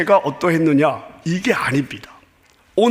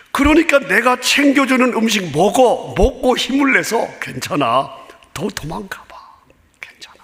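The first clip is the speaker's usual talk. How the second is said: reassuring God; 5 semitones higher than usual. Pretend. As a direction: comfort him like a tender father. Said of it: "이렇게 말씀을 하십니다". (다정하게 달래듯, 점점 속삭이며)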